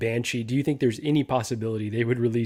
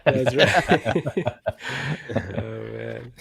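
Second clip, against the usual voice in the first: laughing; much slower than usual. Real, not acted. Chat laughing at a joke.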